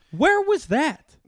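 high, loud, drawn-out disbelief; incredulous mock outcry. Reacting to the collector's cheaper offer, loudly incredulous. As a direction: stretch it out slowly, loud and very high.

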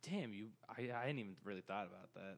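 low hushed realisation; stunned whisper. Real, not acted. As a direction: whisper it, floored by the idea.

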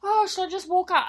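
high, loud imitated panic. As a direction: squeal it in a mock panic. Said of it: "He's having an absolute panic".

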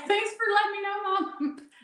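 high-pitched sarcastic gratitude; mock thanks. Daughter to mom over family secret, high mock thanks.

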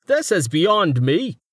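high, slow character voice; baffled scholar. A narrator's reading. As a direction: own up in a high, drawn-out voice. Roma's put-on character voice.

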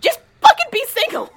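high, loud exasperated yell; exasperated shout. Real, not acted. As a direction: shout it out in mock despair, pitch way up. Exasperated outburst at co-host's argument.

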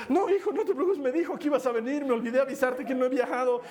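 high mock-breathless reassurance; shaken uncle. Uncle excusing himself to nephew after the scare, shaken. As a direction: play an uncle recovering from a fright. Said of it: "él también respiraba así medio muerto".

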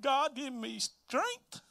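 high, soft, drawn-out plea to God; imagined plea for strength, quiet and slow; pleading prayer. Acted. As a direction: beseech softly in a high, stretched voice. Pregnant Mary dreading telling Joseph.

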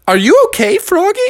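put-on high, sweet girl voice; high Beatrice voice. A narrator's reading. Beatrice checking on fallen Froggy, high and sweet. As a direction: lift into a high girlish voice.

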